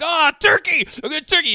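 high, loud boozy bellow over the turkey; rowdy drunk. Retold or imitated. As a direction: whoop it up like a drunk wrecking dinner. Drunk rich man making a scene.

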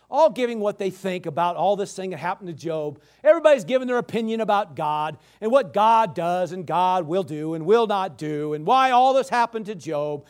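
ordinary talk, nothing marked

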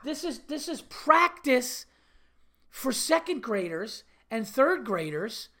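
slow, high incredulous protest; exasperated disbelief. Acted. Watching dad to overzealous coach, slow exasperation. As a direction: stretch it out in disbelief.

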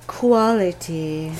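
Quality. stretched out slowly and low; slow emphasis. Retold or imitated. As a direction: linger on Quality. as if it were precious. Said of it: "Heaven says".

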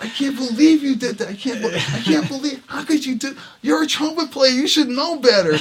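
high, loud outraged rant; retold screaming. Trumpet player screaming at the bandleader after the set, retold screaming. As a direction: yell it in high-pitched disbelief, retelling. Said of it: "I'm screaming at him".

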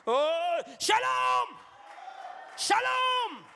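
high, loud shouted shalom; joyful shout. Shown as a demonstration. Pastor blessing the congregation, shouting. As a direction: shout the greeting with joy.

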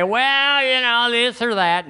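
high mock whine on Well, trailing off; mock evasive whine, pitched high; whiny hedging. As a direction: imitate a whiner beating around the bush. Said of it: "Don't go around the corner and say".

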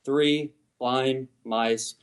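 each word started and stopped very slowly; very slow, clipped cadence. Shown as a demonstration. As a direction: say three blind mice slowly, cutting off every word. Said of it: "start the note, stop the note".

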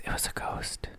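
hushed, confiding whisper. Pretend. Host explains the edited-out visitor to listeners, whispered. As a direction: whisper it as a spooky secret.

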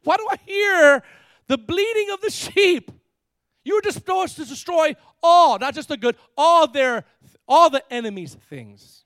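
loud, high, hammed-up rebuke; indignant confrontation. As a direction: challenge Saul at full volume, hitting the key words. Acts Samuel confronting Saul over sheep.

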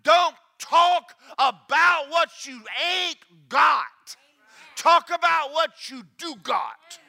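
loud preacher's shout; shouted command. Acted. Preacher commanding the church, shouting. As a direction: drive it home at full volume. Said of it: "preacher shouting the command".

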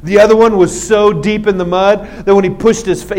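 ordinary talk

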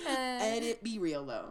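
big laugh breaking into words. Real, not acted. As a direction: laugh through the line. Laughing at meme.

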